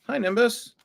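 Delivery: high, rising greeting; high-pitched hello to a cat; talking to a pet. Real, not acted.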